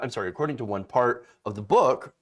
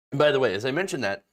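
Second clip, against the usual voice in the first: usual speaking voice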